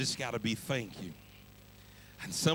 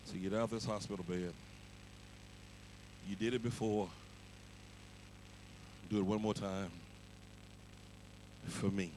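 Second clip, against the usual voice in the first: much softer, lower, begging the Lord; soft pleading prayer; 6 semitones lower, 11 dB quieter than usual. Pretend. Believer quietly begging God to heal mama. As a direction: pray it low and quietly, pleading.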